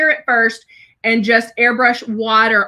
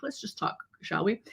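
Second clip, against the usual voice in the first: whispered aside; 6 semitones lower, 15 dB quieter than usual. Retold or imitated. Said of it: "I'm like".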